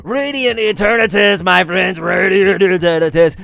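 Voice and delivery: high, loud hyped announcement; excited reveal. Real, not acted. Streamer revealing a card to friends, high excited shout. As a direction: burst out with the card's name.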